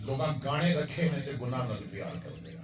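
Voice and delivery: usual speaking voice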